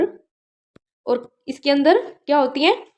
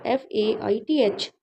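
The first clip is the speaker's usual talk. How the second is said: slow and softer, letters for students to write; slow spelled dictation; 3 semitones lower, 6 dB quieter, much slower than usual. Shown as a demonstration. Teacher spells the word for students to copy, slow and soft. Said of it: "आप मेरी voice सुन के लिख लेना".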